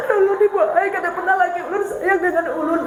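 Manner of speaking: high, teary complaint voice; mock crying wife. Mother complaining to the speaker that husband never cares, tearful and high. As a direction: whine like a wife on the verge of tears. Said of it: "Sudah tuh nangis".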